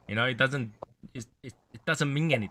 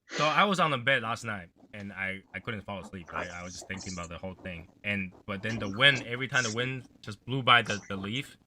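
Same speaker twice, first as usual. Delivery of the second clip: normal talking voice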